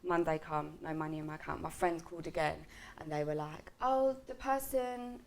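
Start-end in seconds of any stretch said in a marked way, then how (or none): 3.8-5.2 higher, drawn-out stalling 'oh'; put-on slow phone politeness; stalling receptionist. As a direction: imitate an office worker stalling, slowly. Imitating office staff on phone.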